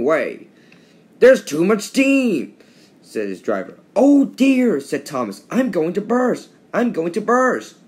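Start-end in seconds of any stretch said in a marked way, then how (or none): 1.2-2.5 high, loud and slow, alarmed at the steam. Acted. Driver warns Thomas there is too much steam, alarmed. 3.9-7.8 high and loud panic, sure he will burst; panicked. Acted. Thomas, in pain, fears he will burst, panicked.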